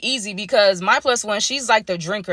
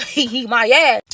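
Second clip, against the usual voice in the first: usual speaking voice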